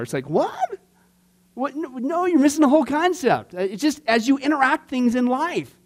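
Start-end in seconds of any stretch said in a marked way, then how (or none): 0.3-3.5 incredulous what. Real, not acted. Incredulous reaction to complainers.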